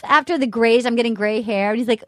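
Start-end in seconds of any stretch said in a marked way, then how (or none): none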